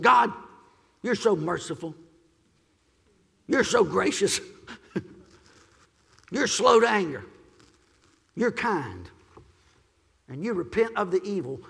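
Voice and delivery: mock praise thrown like a charge; the prophet sulking at God over Nineveh, lightly accusing; Jonah's accusatory tone. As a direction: list God's kindness as if it's a complaint. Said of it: "in an accusatory tone".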